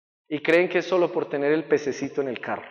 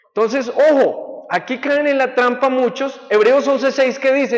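fervent preaching; 8 semitones higher, 7 dB louder than usual. Acted. Preaching climax.

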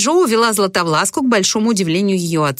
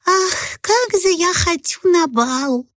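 put-on high, slow, longing little girl; wistful little Cinderella; 11 semitones higher, much slower than usual. A narrator's reading. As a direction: sigh it out like a dreamy child. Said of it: "тихонько вздохнула и произнесла".

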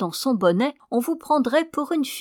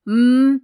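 loud, higher grumbling hum; grunt; 4 semitones higher, 9 dB louder than usual. A narrator's reading. Husband tapped on the shoulder while dozing, loud grunt. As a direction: grunt loudly in protest without opening the mouth. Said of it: "fait monsieur en grognant".

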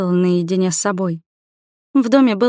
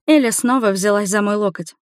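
plain reading voice

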